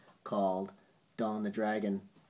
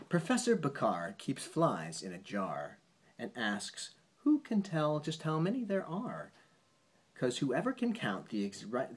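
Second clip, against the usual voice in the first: bouncy rhyming read-aloud; reading a poem to a child; 5 semitones higher, 5 dB louder than usual. Acted. Parent reading a bedtime poem to a child, sing-song read-aloud. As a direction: lilt the rhyme for a child at bedtime.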